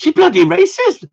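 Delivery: very high, loud mock accusation; hammed-up shocked squawk; mock outrage. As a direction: cry it out as if scandalised. Repeats mock accusation, escalating.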